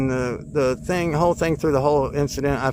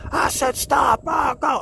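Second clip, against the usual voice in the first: mock yell; 14 semitones higher, slower than usual. Angry man yelling at his dog, imitated high shout. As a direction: bellow it like the angry dog owner. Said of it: "like, yelling".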